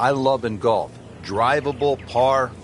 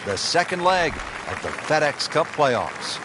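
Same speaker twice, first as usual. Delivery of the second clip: announcer voice; 4 semitones higher than usual. Acted. Broadcast intro announcement.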